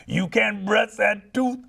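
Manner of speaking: hammed-up jazz crooner jingle. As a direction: croon it slow like a jazz trumpet singer. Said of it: "a [name] voice comes in".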